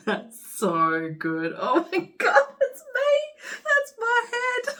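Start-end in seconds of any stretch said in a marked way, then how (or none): 1.6-4.8 high, teary squeal of delight; almost crying with joy; high joyful squeal, close to tears; overjoyed. Real, not acted. Overwhelmed delight at gift.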